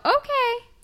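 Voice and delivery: high, sing-song okay; high chirpy mom okay; mom's breezy okay. Retold or imitated. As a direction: toss off a cheerful okay like mom. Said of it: "And she'd go".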